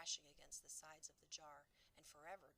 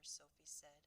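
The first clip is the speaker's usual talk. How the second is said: plain reading voice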